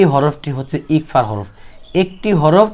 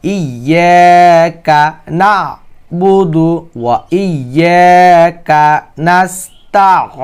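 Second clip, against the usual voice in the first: slow, drawn-out verse recitation; Quran recitation; 2 semitones higher, 5 dB louder, much slower than usual. Shown as a demonstration. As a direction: recite slowly, stretching the long vowels and the final syllable.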